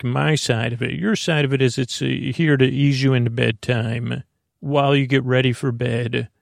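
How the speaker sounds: ordinary talk, nothing marked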